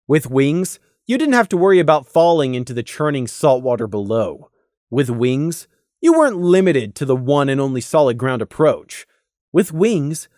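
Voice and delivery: plain reading voice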